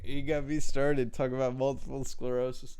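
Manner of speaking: ordinary talk, nothing marked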